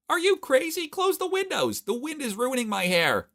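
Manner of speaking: high, loud shrill-free shout from Mila. Acted. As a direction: scream at him to close the windows. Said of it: "Mila clutched her head as she shouted".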